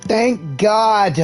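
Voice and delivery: ordinary delivery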